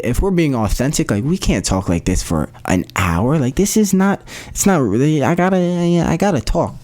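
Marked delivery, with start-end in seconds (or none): 3.4-6.8 high-pitched, worked-up insistence; animated protest. Real, not acted. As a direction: burst out of the calm talk, pitch jumping. Protests against talking calmly.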